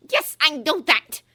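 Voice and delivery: high, loud, slow snap; snapped. A narrator's reading. Umbridge snapping impatiently at Ron, high and loud. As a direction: bite off each word. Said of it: "snapped Umbridge".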